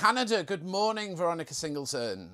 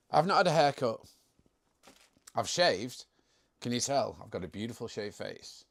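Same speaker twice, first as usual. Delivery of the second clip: normal talking voice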